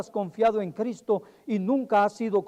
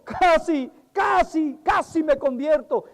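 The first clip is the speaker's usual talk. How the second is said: high, wailing casi; anguished cry; 5 semitones higher, slower than usual. Acted. A lost soul in hell wailing high. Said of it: "escuchar esa voz gritando".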